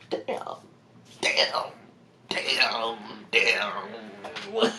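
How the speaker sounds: soft, drawn-out muttering; muttered dismay. Retold or imitated. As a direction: mutter it slowly under your breath.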